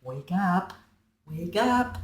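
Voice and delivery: hushed, softly urgent nudge; acted whisper. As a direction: softly coax a sleeper awake, close to the ear. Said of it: "whispering and tugging at your ear saying".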